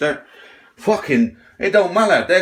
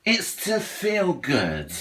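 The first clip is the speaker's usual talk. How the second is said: slower, softer, dragged-out repeat of the excuse; second take quieter and slower; softer mocking echo; 2 semitones higher, 5 dB quieter, much slower than usual. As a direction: let the mockery fade as you repeat it. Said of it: "everyone says".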